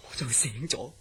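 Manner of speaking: usual speaking voice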